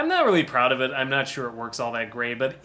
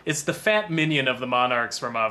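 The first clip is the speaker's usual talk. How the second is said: usual speaking voice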